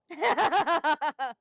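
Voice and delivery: real self-amused laugh; loud laugh. Real, not acted. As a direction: crack up loudly. Laughs after admitting crying.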